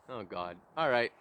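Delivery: normal talking voice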